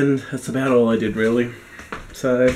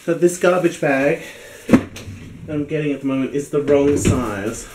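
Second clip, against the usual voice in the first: ordinary talk, nothing marked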